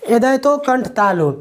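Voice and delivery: ordinary delivery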